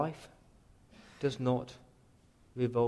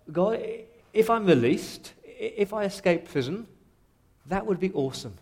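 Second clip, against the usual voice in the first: higher-pitched, eager pleading to God; excited, hopeful prayer; 4 semitones higher, 4 dB louder than usual. Acted. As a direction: pile up the hopes with rising fervour, then settle. Acts Paul hoping for release.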